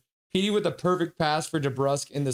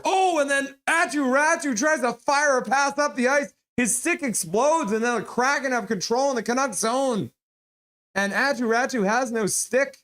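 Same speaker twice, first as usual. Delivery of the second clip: excited play-by-play; 8 semitones higher than usual. Real, not acted. Stick explodes in play-by-play.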